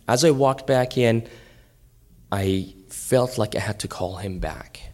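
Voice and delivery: ordinary talk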